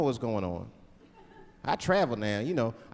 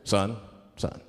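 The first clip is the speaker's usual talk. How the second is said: police officer; 11 semitones lower than usual. Acted. Officer to a driver pulled over, low authoritative voice. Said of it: "the police pull me over".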